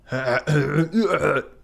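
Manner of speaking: clearing the throat to find a voice. Shown as a demonstration. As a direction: cough to get back into character. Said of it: "Why have I lost that?".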